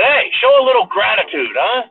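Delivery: put-on high, loud scolding old man; mock Biden scolding. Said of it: "And he said".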